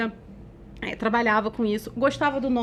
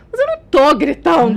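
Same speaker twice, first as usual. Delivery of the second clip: loud, raised-pitch protest; shouted denial; 4 semitones higher, 8 dB louder than usual. Retold or imitated. Defending herself to her business partner, shouted denial. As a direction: yell while insisting you aren't yelling. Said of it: "gritou mais ainda".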